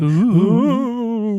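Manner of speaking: high, loud ghostly ooh; ghostly wail. Pretend. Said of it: "I will literally do the ghost voice".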